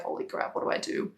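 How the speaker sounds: whispered mock panic; imitated hushed dismay. Troubled client asking the coach for help, imitated in a hushed whisper. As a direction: quote it in a voiceless whisper.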